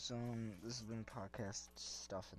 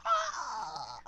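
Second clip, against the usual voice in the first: high loud mock shriek; clown shriek; 15 dB louder than usual. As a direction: shriek it out suddenly. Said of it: "And he's like".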